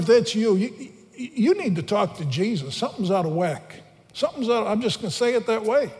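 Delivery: ordinary delivery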